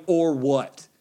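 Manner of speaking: normal talking voice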